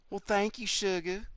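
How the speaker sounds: female voice. Pretend. Streamer thanking a viewer in a lightly feminine Southern voice. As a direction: coo it like a Southern belle. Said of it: "that's more like how the females talk".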